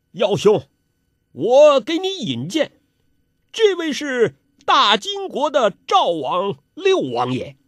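high-pitched, projected presentation; grand introduction. A narrator's reading. Host presenting the prince to a newcomer, grandly announcing. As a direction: announce an important guest with a flourish.

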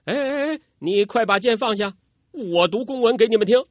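high, hurried nervous pleading; nervous officer. A narrator's reading. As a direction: plead quickly under an aimed arrow. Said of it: "武官心头发毛啊".

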